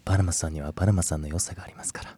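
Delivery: soft, unvoiced whisper. Real, not acted. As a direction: breathe the compliment close to the mic. Sweet compliment to co-host. (ささやき声)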